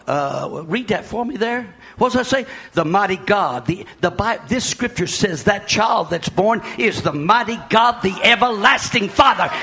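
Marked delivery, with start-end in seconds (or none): 4.5-9.5 loud, high shouted proclamation; preaching at full force. Acted. Pastor proclaiming to the congregation, shouting. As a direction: build up and shout the doctrine.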